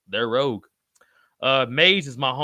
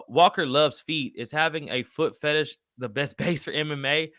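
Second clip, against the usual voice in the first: usual speaking voice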